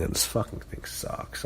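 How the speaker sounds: whispered under his breath in disgust; a fed-up whispered grumble. Real, not acted. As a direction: hiss it quietly, fed up. Disgusted aside about a feud he hates.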